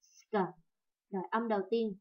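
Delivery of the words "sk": "Sk" with a drawn-out s; long s. Shown as a demonstration. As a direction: stretch the s of "Sk". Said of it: "đọc cái âm S nó dài ra".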